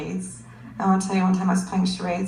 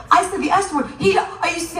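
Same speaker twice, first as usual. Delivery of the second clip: shocked teacher; 8 semitones higher, 3 dB louder than usual. Retold or imitated.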